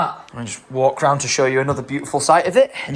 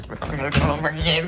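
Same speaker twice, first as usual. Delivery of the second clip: fast repeat; much faster than usual. Real, not acted. Repeating plea to escape.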